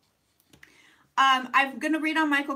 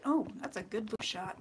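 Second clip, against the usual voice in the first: normal talking voice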